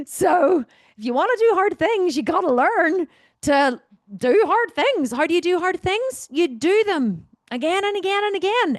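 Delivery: rally the audience hard; fired up. Real, not acted.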